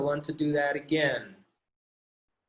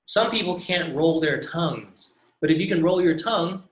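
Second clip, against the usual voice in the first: ordinary talk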